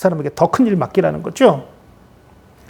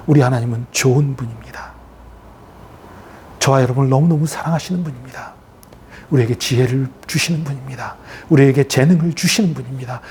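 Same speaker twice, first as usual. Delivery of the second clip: tender reassurance; 4 semitones lower than usual. Real, not acted. Preacher assuring congregation of God's love, tender reassurance. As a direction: assure them gently, pitched low.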